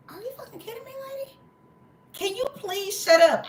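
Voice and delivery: very high retold disbelief, building in volume; exasperated outburst. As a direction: start small and squeaky, build to fed-up. Retells snapping at the lady.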